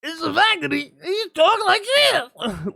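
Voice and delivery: high, strained comic screech, drawn out; Bobcat Goldthwait voice. Acted. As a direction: shriek it like a squawking cartoon voice. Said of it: "that guy with that voice".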